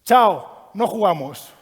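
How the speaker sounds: loud, decisive call; firm walk-off. Retold or imitated. Coach pulls the team off a violent match, loud. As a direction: end the match on the spot. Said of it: "¿sabes qué hacen?".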